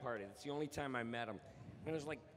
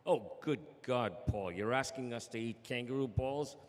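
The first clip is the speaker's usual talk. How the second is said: an Aussie asking if you're serious; Australian accent; 4 dB louder than usual. Acted. Australian pitchman's mock shocked reply, lightly accented. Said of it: "awesome Australian accent".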